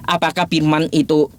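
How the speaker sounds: ordinary delivery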